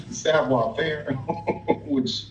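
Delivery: amused. Real, not acted. Laughs at own wrong word.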